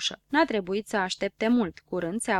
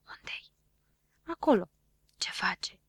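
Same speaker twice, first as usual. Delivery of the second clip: hushed whispers behind a door; whispering. A narrator's reading. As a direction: whisper it, barely voiced. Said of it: "se auziră pași și vorbe șoptite". (șoaptă fără glas, din spatele ușii)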